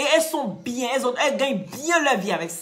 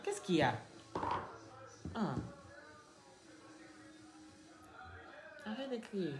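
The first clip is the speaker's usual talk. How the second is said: quiet scolding; 3 semitones lower, 14 dB quieter than usual. Real, not acted. Mother quietly tells her child to stop shouting. Hushing the child mid-live.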